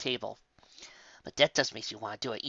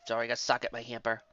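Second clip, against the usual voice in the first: usual speaking voice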